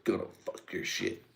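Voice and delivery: normal talking voice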